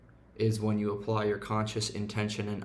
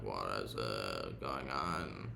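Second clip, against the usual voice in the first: much lower, soft, groggy voice; groggy inner voice; 12 semitones lower, 9 dB quieter, slower than usual. Retold or imitated. Imitating his tired morning self, low and groggy. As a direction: sink low and drag it out, half asleep. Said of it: "I'm like".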